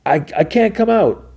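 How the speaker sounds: high, strained yell-back without full volume; stammer, then call it out high; imitated panicky refusal. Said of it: "And he yells".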